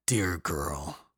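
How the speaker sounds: sad. Acted. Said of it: "sadness filling his bright eyes".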